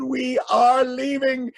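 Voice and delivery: high, loud, slow declaration; mock indignant shout. A narrator's reading. Townspeople quitting lion-filled kingdom, high drawn-out shout. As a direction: stretch each word in loud protest. Townspeople announce they are fleeing.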